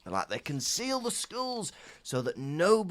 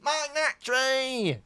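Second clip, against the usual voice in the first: much higher, slow cartoon voice; drawn out, high-pitched warning; Wallace voice; 12 semitones higher, 3 dB louder, slower than usual. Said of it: "He sounds like Wallace and Gromit".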